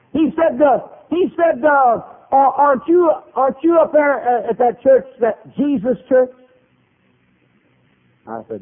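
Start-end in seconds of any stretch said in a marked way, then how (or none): 0.1-6.3 high, loud, stumbling question; excited halting stranger. Retold or imitated. Fellow asking the preacher about his church, loud and halting. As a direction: grope for the words at high pitch. Acting stranger accosting him.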